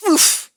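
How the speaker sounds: loud grunt as wind knocked out; winded oof. A narrator's reading. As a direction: hit the ground grunt. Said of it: "as the wind was knocked out of him".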